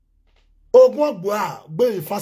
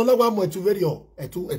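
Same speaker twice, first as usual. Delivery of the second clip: ordinary talk, nothing marked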